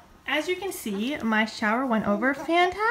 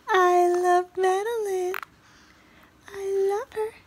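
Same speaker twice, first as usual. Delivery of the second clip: slow, higher sing-song coo; 9 semitones higher, 5 dB louder, slower than usual. Real, not acted. Mom crooning love to baby Madeline in baby talk.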